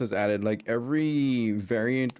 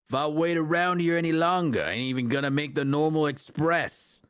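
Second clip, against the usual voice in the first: ordinary delivery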